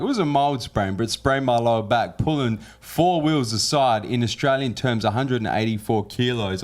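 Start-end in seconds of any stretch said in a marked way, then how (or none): none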